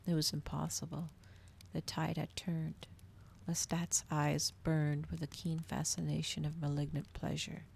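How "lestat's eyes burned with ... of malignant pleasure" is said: ordinary delivery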